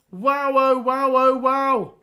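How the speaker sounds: loud, elated wows at a late winner; ecstatic wows. Real, not acted. Fan commentator shouting wow at the winning goal, thrilled. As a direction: let it burst out of you in joy. Last-minute winner at Wembley.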